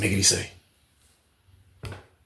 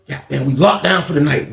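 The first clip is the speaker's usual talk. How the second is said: ordinary talk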